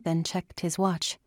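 plain reading voice

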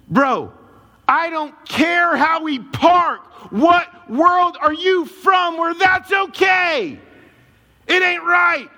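high, drawn-out outraged yelling; full-voiced indignant shouting; angry shout. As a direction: yell it at the neighbor in outrage. Retold angry confrontation with neighbor.

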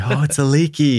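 starstruck gush. Retold or imitated. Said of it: "I turned into the ten-year-old child".

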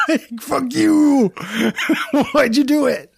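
laughing. Retold or imitated. Voicing Heidi Fleiss scene.

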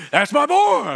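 high, loud sideline holler; proud dad yelling. Acted. Dad to his kid from the bleachers, proud yell. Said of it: "they're just yelling in the background".

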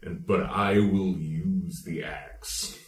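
slow, soft, chilling menace; hint of a menacing villain. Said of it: "[name]'s delivery of".

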